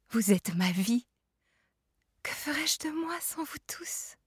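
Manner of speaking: soft murmur fading to a high whisper. A narrator's reading. Mother holding her child, soft murmur.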